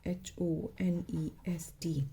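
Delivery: slow spelled out. Shown as a demonstration. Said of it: "honest".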